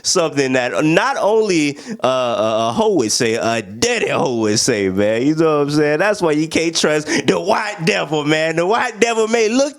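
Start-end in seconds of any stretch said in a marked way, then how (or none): none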